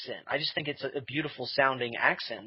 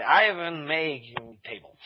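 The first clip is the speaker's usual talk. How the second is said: put-on Russian accent. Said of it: "this Russian person I was saying was, was Ivan".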